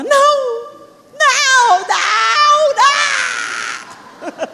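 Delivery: put-on high wailing child protesting no; child's dramatic wail. Mother imitates daughter's tantrum, high crying wail. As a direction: play the toddler throwing a fit, crying no. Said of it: "Fazia um drama".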